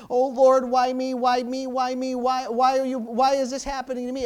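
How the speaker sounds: imitating a self-pitying moan to God; a touch of a complaining, faint believer; mock whining prayer. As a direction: whine "why me" like a defeated man. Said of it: "was just faint and just was like".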